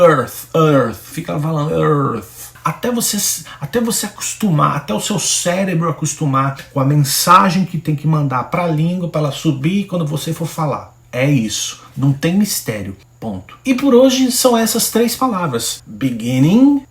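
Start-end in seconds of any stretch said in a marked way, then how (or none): 0.1-1.0 "earth, earth" drilled with the tongue between the teeth; repeated earth drill. Shown as a demonstration. Teacher drills earth the way students should at the mirror. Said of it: "vai pro espelho e fala". 15.8-16.8 "Beginning" said slowly with a rising end; slow model word. Shown as a demonstration. Teacher models a word for students to repeat. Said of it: "pronuncia aí".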